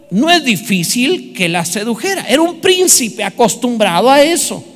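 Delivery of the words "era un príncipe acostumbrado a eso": ordinary delivery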